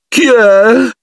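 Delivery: a wounded little ghost gurgling from her cubicle; pitiful gurgle. Acted. Ghost girl answering the boys from her stall, pitiful high gurgle. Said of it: "gorgogliò lei con una voce da far pietà".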